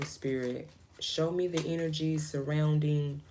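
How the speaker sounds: ordinary delivery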